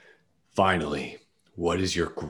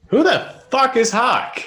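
shocked outburst; 7 dB louder than usual. Real, not acted. Shocked by quiz result.

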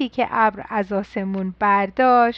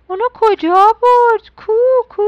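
higher and louder, calling out for the hat; mama voice; 8 semitones higher, 7 dB louder than usual. A narrator's reading. Mama asking the child where the hat went, high and loud. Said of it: "مامان قوله گفت".

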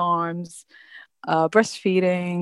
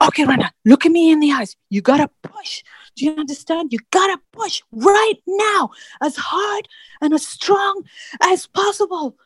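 high, insistent push orders; urgent doctor; 9 semitones higher than usual. Retold or imitated. Doctor orders mother to push right now, urgent and high. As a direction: imitate a doctor's urgent command, pitch climbing high.

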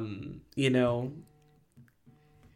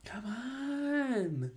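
drawn-out plea; 9 semitones higher, 13 dB quieter than usual. Pretend. Pleading with Paige.

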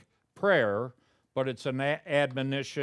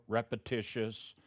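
ordinary talk, nothing marked

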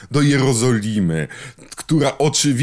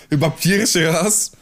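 usual speaking voice

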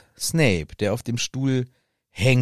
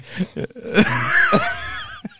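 shrieking laugh, much higher; high laugh burst. Real, not acted. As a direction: explode into a squeal of laughter. (hoher, quiekender Lachanfall)

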